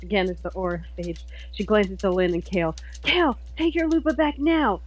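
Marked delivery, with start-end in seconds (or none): 3.0-4.8 high-pitched call to an ally; urgent battle command. Acted. Star ordering Kale mid-battle, urgent command. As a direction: order them back, voice raised in pitch.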